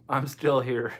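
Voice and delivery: ordinary talk, nothing marked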